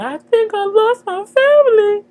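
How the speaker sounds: high wailing sob, hammed up; mock crying. Recapper mimics Nova sobbing on the phone, hammed-up high wail. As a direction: wail it like an overdone crybaby. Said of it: "And she crying".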